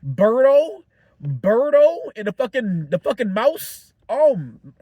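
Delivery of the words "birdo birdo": loud slow rising shout of a name; calling out a surprise favorite; excited. Real, not acted. Excited about a cameo.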